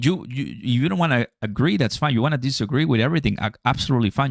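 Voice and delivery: normal talking voice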